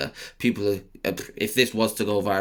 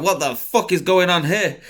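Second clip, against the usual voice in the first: high, loud, laughing outburst; cracking up in the elevator; laughing disbelief; 9 semitones higher, 5 dB louder than usual. Retold or imitated. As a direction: laugh through the question.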